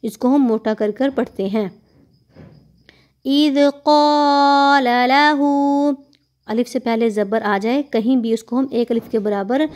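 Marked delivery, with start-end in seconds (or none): 3.2-6.0 slow chanted recitation, heavy qaf; stretched tajweed chant; Quranic recitation. Shown as a demonstration. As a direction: recite slowly and melodically. Recites verse after explaining.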